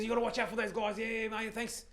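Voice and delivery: put-on high voice of an out-of-it man; homeless man's voice. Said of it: "that's how he speaks".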